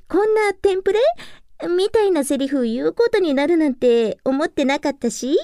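ordinary talk, nothing marked

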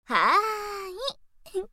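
a very slow, sing-song はーい; drawn-out teasing yes. Acted. Big sister accepting her little brother's bet, slow teasing drawl. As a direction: stretch the yes out lazily and tease.

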